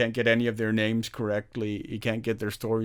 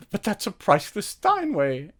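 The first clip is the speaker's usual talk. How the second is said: maid voice; 10 semitones higher, 3 dB louder than usual. Retold or imitated. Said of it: "And she goes like".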